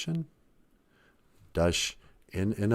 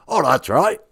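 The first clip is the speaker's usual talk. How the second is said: higher, far-off regal English lady voice; Queen of England voice; 6 semitones higher, 5 dB louder than usual. Acted. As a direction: speak as a grand queenly deity. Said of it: "you kinda sound like the Queen of England".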